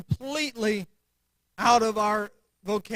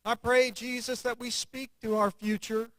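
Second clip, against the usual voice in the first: prayer; 4 semitones lower than usual. Acted. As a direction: pray it gently then rise in fervour. Closing prayer.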